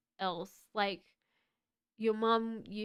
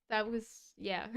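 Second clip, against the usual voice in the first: normal talking voice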